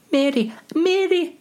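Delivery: very high falsetto; squeaky hobbit. Acted. Hobbit pipes up by name, high falsetto.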